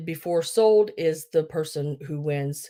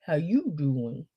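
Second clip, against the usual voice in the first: slow, accented "how you doin'"; put-on "How you doin'" accent; 3 semitones lower, 4 dB quieter, slower than usual. Shown as a demonstration. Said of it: "my accent there".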